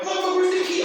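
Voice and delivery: hammed-up panic while fumbling for the key; panicked yelp. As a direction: shriek it like you're losing control. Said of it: "I'm like".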